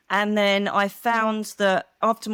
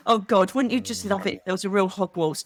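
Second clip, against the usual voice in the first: ordinary delivery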